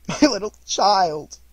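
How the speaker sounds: acted sobbing wail, high and very loud; anguished father's cry; breaking down. A narrator's reading. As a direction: burst into grief, stretching the words out. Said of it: "He broke down all at once".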